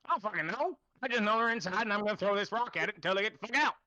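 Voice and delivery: put-on foul-mouthed old man on the defensive; cantankerous old man, exasperated. Game master plays old man Darren.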